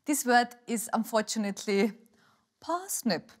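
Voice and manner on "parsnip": parsnip said the British way, long open a, no r. Shown as a demonstration. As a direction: say parsnip like a Brit, pahsnip. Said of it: "makes me sound really British".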